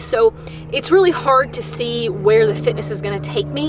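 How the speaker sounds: normal talking voice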